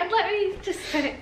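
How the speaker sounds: words squeezed out through a real laughing fit; giggling too hard to get the words out. Real, not acted. Partner asks about coming out, she cracks up, laughing.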